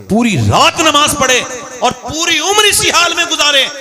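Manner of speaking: fiery preacher shouting to crowd; booming political rally speech. Acted. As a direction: build to a roaring climax. Fervent preaching climax.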